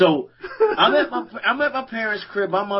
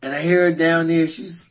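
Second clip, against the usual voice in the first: ordinary talk, nothing marked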